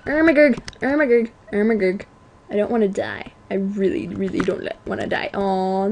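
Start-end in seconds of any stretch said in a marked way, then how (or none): 0.1-2.1 every vowel of "Ermagurg" turned into "er"; garbled meme exclamation. Pretend. Gamer panicking jokily in a fight, "Ermagurg" with r-coloured vowels. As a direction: say "Ermagurg" as a mangled, r-heavy "oh my god", stepping down each time.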